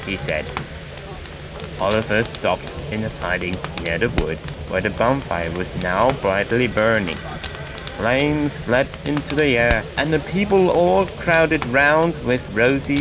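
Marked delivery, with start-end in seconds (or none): none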